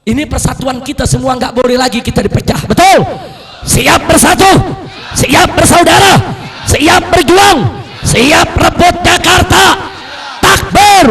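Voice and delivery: loud, high call-and-response shouts; fiery rallying. Real, not acted. Preacher calls the crowd to fight for Jakarta, fiery shout.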